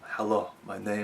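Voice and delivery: lightly voiced swordsman's vow; a hint of the avenging swordsman, half laughing; Inigo Montoya quote. Host quoting the film's famous line, lightly acted swordsman. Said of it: "I just remember his line now".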